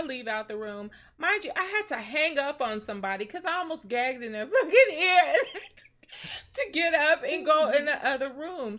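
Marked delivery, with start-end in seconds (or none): 1.2-8.8 laughing. Real, not acted. Recalls almost gagging on a call.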